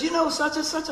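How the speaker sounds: excited high query; eager question. Retold or imitated. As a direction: pitch it way up, keen to know. Friend asks eagerly.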